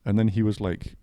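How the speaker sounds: usual speaking voice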